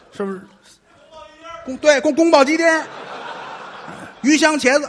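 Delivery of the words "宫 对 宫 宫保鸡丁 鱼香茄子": louder, belted out like a waiter's call; a restaurant crier's shouted menu item; loud dish-name calling. Acted. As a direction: announce the dish to the whole hall. Reciting dish names on stage.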